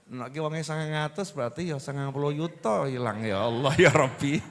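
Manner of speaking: low, soft grumbling over lost money; mock lament. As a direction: mutter a playful complaint up to God.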